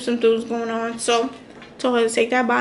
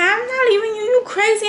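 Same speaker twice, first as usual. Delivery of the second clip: lightly voiced sister; 8 semitones higher than usual. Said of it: "She like".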